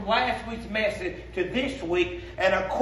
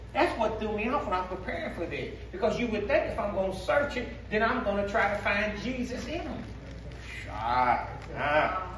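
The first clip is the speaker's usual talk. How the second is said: normal talking voice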